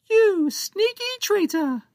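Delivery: outraged slow accusation from a tiny pirate; tiny pirate captain accusing Captain Joe of treachery. Acted. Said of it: "Captain Big accused".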